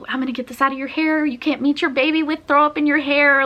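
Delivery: a touch of the doctor being sweet; kind doctor. Retold or imitated. Doctor kindly cleaning her hair.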